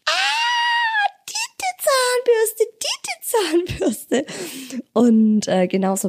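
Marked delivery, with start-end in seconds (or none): 0.1-4.3 put-on very high squealing child; excited toddler voice.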